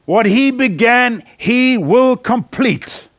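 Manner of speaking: What he began, he will complete, slow and weighty; slow emphatic declaration. Acted. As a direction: stretch will complete out with conviction. Sermon climax, slowed.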